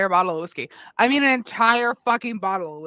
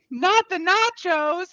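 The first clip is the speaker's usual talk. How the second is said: high, slow alarmed plea; 9 semitones higher, much slower than usual. Real, not acted. Nachos nearly spilled.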